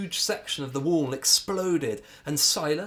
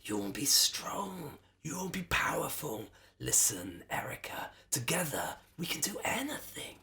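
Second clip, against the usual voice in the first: hissing wheedle; 4 semitones higher, 3 dB quieter than usual. A narrator's reading. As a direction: tempt her with a hiss. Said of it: "The anger mare hissed and wheedled".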